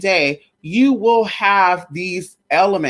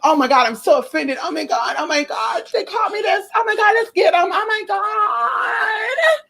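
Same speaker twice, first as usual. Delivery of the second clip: higher, rising mock outrage ending in a wailing cry; mock offended; 13 semitones higher than usual. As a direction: ham up a wounded overreaction. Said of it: "If somebody call you stupid".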